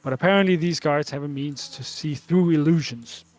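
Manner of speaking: normal talking voice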